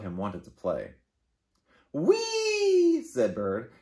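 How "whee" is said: high gleeful whee; excited squeal. Acted. Bird squealing at bedtime, excited. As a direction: squeal with delight like a playful bird.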